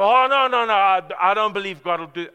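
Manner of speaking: loud, high, fast mock denial; mock protest. The preacher mocks people who doubt God will act. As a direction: ham up a scoffer's disbelief. Mocking skeptic's objection.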